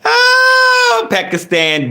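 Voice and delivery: a loud, high excited oh; excited greeting. Real, not acted. Streamer excitedly greets a viewer from Pakistan. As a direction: shout the greeting with delight.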